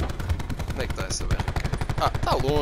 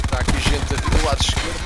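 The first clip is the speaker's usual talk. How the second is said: alert call; 4 semitones higher, 7 dB louder than usual. Real, not acted. Calling enemies on left.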